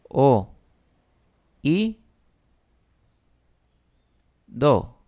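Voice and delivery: "O, i, do" one syllable at a time, long pauses between; "O... i... do", slowly spelled out by syllable; split into syllables. Shown as a demonstration. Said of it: "Si las separamos en sílabas".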